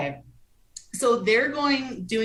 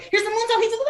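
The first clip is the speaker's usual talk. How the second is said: high-pitched, frantic blurt of a line; squeaky, hurried call of the move; rushed commentary; 14 semitones higher, faster than usual. Shown as a demonstration. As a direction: rattle it out in a panicked rush to keep up. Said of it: "You literally hear me in the cadence of".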